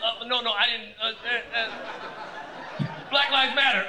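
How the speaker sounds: high, loud panicked stammer; flustered stammer. Retold or imitated. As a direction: fumble for the right thing to say. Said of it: "He's like".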